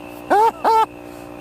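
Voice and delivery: high, loud burst of laughter; real helpless giggling; laughing. Real, not acted. Still cracking up.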